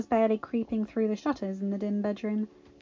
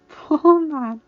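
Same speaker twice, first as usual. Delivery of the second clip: amused mother; 6 semitones higher, 11 dB louder than usual. A narrator's reading.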